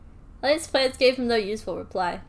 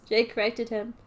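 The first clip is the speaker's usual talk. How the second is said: ordinary delivery, nothing marked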